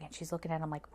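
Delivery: ordinary talk, nothing marked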